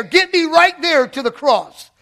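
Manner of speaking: high, loud pleading cry; preaching at full force. Acted. Preacher crying to be brought to the cross. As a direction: push the plea to the rafters.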